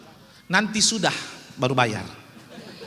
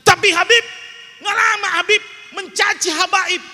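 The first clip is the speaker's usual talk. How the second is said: raised, louder mock protest; mock outcry; 9 semitones higher, 5 dB louder than usual. Preacher voicing a listener's outraged protest, loud. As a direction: call out the objection in a high, loud voice.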